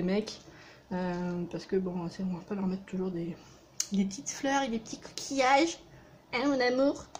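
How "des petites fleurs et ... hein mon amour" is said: higher, playful put-on voice; funny put-on voice. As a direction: tease in a silly high voice. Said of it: "Je sais pas pourquoi je parle comme ça". (voix aiguë et taquine)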